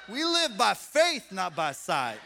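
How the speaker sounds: loud, slow declaration; preaching proclamation. Real, not acted. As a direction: proclaim it with full conviction. Preacher proclaiming at full force.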